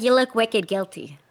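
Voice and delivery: lightly attempted, off-target Boston accent; terrible Boston accent. Mimicking a Boston cop accusing a suspect, bad Boston accent. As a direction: try a Boston accent and botch it. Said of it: "that's terrible Boston accent".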